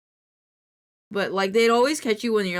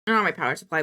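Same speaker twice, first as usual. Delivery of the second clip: normal talking voice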